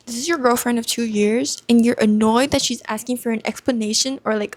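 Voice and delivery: ordinary delivery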